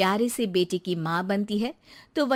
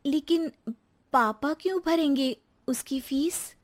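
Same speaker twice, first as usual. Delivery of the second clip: lightly raised voice of a hurt new bride; young wife Rekha; 6 semitones higher than usual. Acted. New bride answers her husband, a touch hurt.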